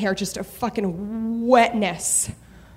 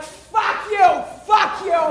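higher, shouted Boston-tinged curse; drunk yelling; 9 semitones higher, slower than usual. Retold or imitated. Comic cursing at drunk bar couple, shouting. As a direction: yell it at the couple.

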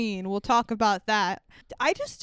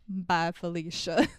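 low, slow, offhand mimicry; dismissive deadpan; 6 semitones lower, 4 dB quieter than usual. Imitating [name] dismissing Felicia without a look, low deadpan. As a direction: drag out a bored brush-off. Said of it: "doesn't even look at her".

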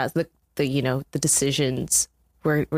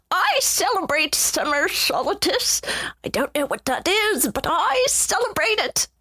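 put-on sweet old lady; 12 semitones higher than usual. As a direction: play a sweet granny, way up high. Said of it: "this is my favorite accent".

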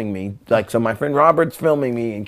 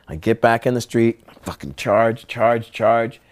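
normal talking voice

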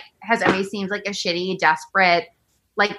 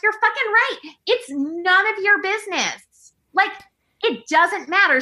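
emphatic agreement; 9 semitones higher than usual. Real, not acted. Emphatic agreement in her head.

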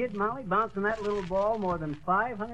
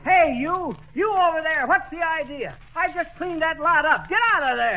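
higher, loud furious shouts; angry yell; 8 semitones higher, 4 dB louder than usual. Acted. McGee yelling angrily at men dumping on his lot. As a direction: chase them off the lot.

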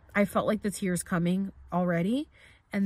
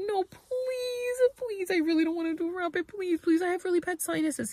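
high-pitched mock begging; desperate pleading; 11 semitones higher than usual. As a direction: act out pleading to be spared. Re-enacts begging the shaman not to.

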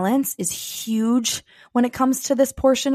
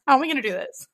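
much higher, fast, half-laughing half-crying; laughing through tears; 6 semitones higher, much faster than usual. Retold or imitated. Mom retelling her panic about leaving her baby, laughing and tearful. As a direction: let the laugh crack into a sob. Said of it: "I literally was like".

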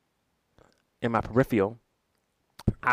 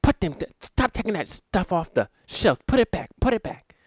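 higher, louder sharp scold; grandma telling the boy off at the shelf, higher and louder; 9 semitones higher, 5 dB louder than usual. Retold or imitated. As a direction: snap at the child like a stern grandma. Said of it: "hits him and says".

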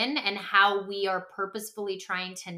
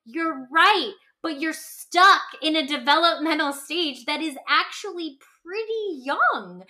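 higher and louder, teasing the point home; mock gentle correction; 5 semitones higher, 5 dB louder than usual. Host telling anxious adults they're still stuck, teasing. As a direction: needle them kindly but firmly. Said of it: "And I go".

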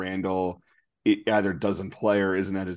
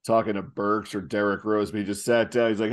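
usual speaking voice

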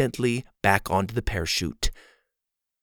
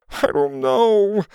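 high, drawn-out whimper; whined; 7 semitones higher, slower than usual. A narrator's reading. Hurt friend answering in the dark, whining. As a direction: whine it slowly, pitched up. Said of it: "Mac whined".

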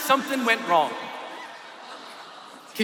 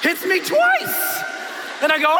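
normal talking voice

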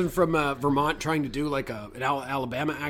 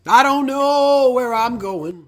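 hammed-up melodrama; 9 semitones higher, 7 dB louder, much slower than usual. Hams up the movie's title line.

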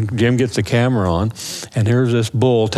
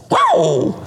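higher, slow drawn bark; mock elk bark; 8 semitones higher than usual. Hunter imitating the bull barking down the hill, drawn-out mock bark. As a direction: stretch it out like a wary elk.

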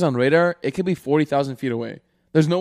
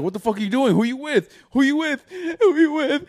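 rising more and more with each question, voice cracking; mock jealous boyfriend, climbing higher; voice cracking anger; 11 semitones higher than usual. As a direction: repeat it more desperate each time. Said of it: "his voice started cracking".

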